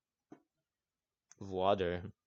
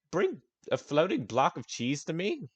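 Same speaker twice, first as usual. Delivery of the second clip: baffled question; 8 semitones higher than usual. Acted. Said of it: "he's like".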